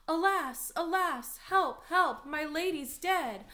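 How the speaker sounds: loud, high cries for help; yelling in alarm. Acted. Nurse finds Juliet dead, yelling for the household. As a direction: yell it out in theatrical panic. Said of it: "I was yelling".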